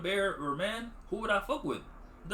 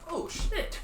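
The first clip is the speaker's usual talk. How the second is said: laughing shock; 12 semitones higher than usual. Retold or imitated. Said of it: "you're like".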